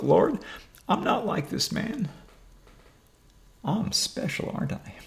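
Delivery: high, slow, smug whine; mock pious whine. Mocking a self-righteous man before God.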